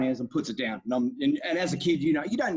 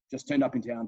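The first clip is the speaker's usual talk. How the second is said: ordinary talk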